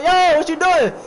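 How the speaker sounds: loud, high-pitched alarmed yell; urgent mock holler; acted shout. Man yelling through door at girl.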